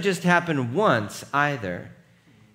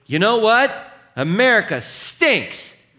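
loud, higher mock taunt; provocative outburst; 9 semitones higher, 9 dB louder, slower than usual. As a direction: blurt it out to a room of veterans. Provoking imagined retired soldiers.

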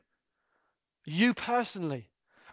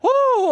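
high, loud elated "woo"; joyful whoop; 10 dB louder than usual. Real, not acted. Preacher whoops with joy at gospel.

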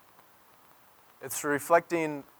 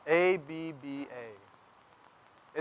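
very slow Abba, then spelling it letter by letter; spelled out slowly; 5 dB quieter, much slower than usual. Shown as a demonstration. Pastor teaching the Aramaic word Abba to the church. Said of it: "this simple word".

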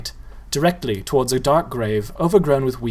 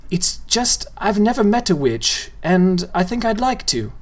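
put-on ponderous boy; 7 semitones higher than usual. A narrator's reading. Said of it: "with a ponderous tone in his voice".

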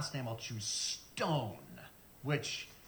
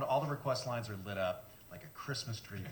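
normal talking voice

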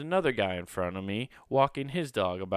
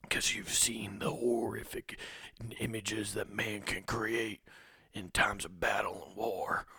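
dramatic whisper; 8 dB quieter than usual. Pretend. Saluting old veteran bikers, mock solemn whisper. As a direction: whisper it like a grim war movie trailer.

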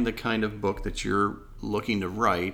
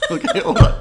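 laughing; 13 dB louder than usual. Real, not acted. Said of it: "I think I'm gonna sneeze".

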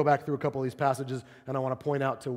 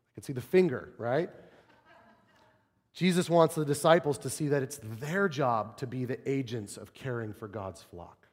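ordinary talk

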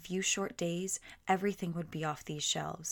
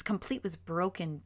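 plain reading voice

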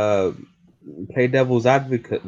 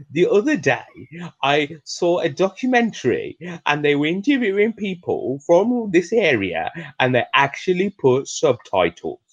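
ordinary talk

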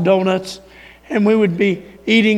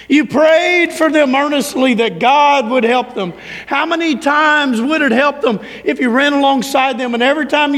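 higher-pitched, driving exhortation; impassioned preaching; 4 semitones higher than usual. Acted. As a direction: preach it with building urgency. Preaches at full force.

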